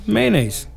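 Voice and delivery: mayonnaise squeezed into MAN-naise; two-syllable mayonnaise. Shown as a demonstration. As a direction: drop the "oh" from mayonnaise. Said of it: "Two syllables".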